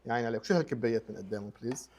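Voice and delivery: ordinary talk, nothing marked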